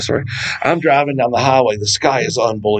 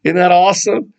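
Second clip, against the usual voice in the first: excited; 6 semitones higher than usual. Retold or imitated. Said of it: "I'm like".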